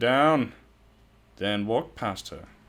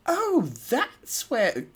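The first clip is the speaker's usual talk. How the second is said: a slow, high, lightly British take; an accidental British lilt; British accent slip; much slower than usual. Shown as a demonstration. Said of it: "why is it British?".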